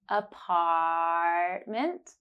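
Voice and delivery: "Apartment" very slow, the ah held long. Shown as a demonstration. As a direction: draw out the ah in "Apartment". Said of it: "hold ah for three seconds".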